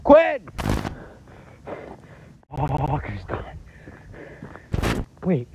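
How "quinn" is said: loud desperate call; shouting a name. Real, not acted. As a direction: yell for your friend. Calling a missing friend.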